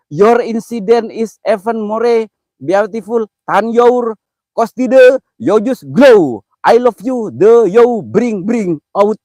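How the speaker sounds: struggling through English on stream; halting English reading. Shown as a demonstration. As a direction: sound out the English comment slowly. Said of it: "Gue bingung ngomongnya kalau bahasa Inggris".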